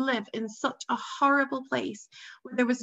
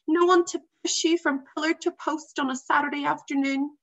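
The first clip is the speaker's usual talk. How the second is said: put-on higher, louder upset young boy; complaining boy voice; 6 semitones higher, 5 dB louder than usual. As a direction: list the losses like a frustrated boy.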